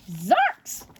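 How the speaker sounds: witch's startled cry; a sharp little witch cry. Acted. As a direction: witch finding her tulips trampled. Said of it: "she cried".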